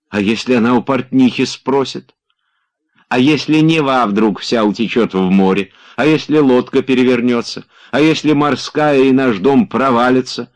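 mock his worries, rising each time; mocking what-ifs. A narrator's reading. Olga mocking Oblomov's anxious questions, teasing build.

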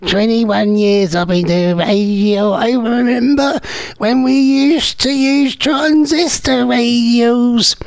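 high, very slow, drawn-out oldie voice; hammed-up old-timer voice; mock old-timer. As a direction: ham up a veteran reminiscing, stretching every word. Mock old-timer reminiscing about radios.

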